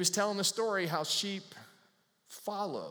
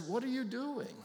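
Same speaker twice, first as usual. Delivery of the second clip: startled question; 8 semitones higher than usual. Retold or imitated. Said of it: "the guide says".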